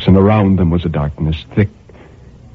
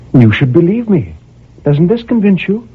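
pleading high; 10 semitones higher, 4 dB louder than usual. A narrator's reading. Woman pleading.

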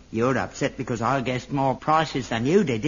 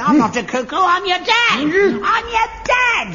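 much higher, loud, terrified cries; panicked pleading; 13 semitones higher, 4 dB louder than usual. Real, not acted. Father pleads with hammer-waving son, panicked shout. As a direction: beg not to be hit.

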